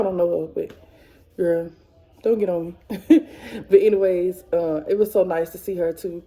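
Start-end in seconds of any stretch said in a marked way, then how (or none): 3.0-3.6 short sheepish burst of laughter; embarrassed laugh. Real, not acted. Vlogger realizes she said her friend's real name, embarrassed laugh. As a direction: laugh it off, caught out.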